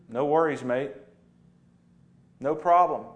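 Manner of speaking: a light Aussie "No worries, mate"; a touch of a laid-back Australian sheep; casual Aussie reassurance, lightly done; Australian accent. Acted. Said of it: "That's how Australian sheep talk".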